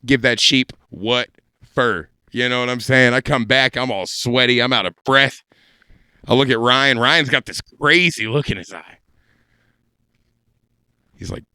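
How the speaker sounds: usual speaking voice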